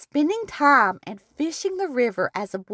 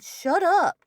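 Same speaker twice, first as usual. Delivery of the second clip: higher-pitched mock crow holler; 6 semitones higher than usual. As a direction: lightly imitate a talking crow's call. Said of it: "which would holler".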